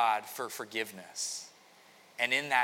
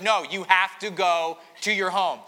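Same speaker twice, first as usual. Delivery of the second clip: insistent king; 4 semitones higher, 7 dB louder than usual. Retold or imitated. David insisting to Uriah.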